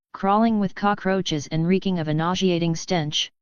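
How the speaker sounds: usual speaking voice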